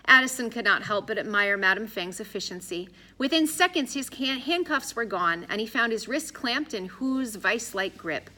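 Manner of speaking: ordinary delivery, nothing marked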